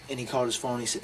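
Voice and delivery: usual speaking voice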